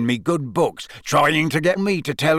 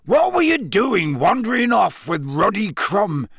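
high, scolding character voice; heated rustic telling-off; Hagrid, angry; 7 semitones higher than usual. A narrator's reading. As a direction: scold with worried exasperation. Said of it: "Hagrid suddenly said angrily".

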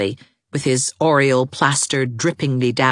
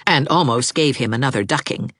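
ordinary delivery, nothing marked

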